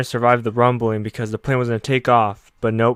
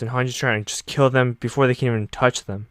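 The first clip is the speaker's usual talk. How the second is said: ordinary talk, nothing marked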